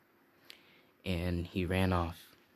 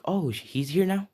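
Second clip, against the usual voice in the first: higher, eager surprised mother; a pitched-up excited mother; surprised mom; 11 semitones higher, 4 dB louder than usual. Acted. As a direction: perk up at the news. Mother surprised Frederick is back.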